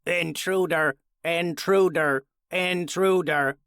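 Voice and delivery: slow, loud, clanking robot alarm; a harsh robot repeating Intruder, slow and loud. A narrator's reading. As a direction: bark it out like a broken machine. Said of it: "the calming voice was a harsh, robotic sound repeating".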